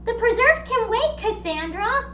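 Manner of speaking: high-pitched, scandalized protest; mock indignation. Telling off the neighbor with the preserves, mock indignant. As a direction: scold her in a high, flustered voice. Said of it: "And then I'm like".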